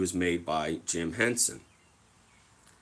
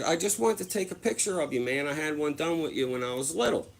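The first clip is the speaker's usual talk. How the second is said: higher, lightly pleading; mock innocent; 6 semitones higher than usual. Explaining to the mascot why he wanted a photo, lightly pleading. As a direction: explain yourself sheepishly. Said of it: "And I said".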